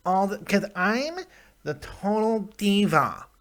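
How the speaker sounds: mocking, high, drawn-out diva voice; exaggerated put-on diva impression; Dana Brooke. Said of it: "I can't stand her voice".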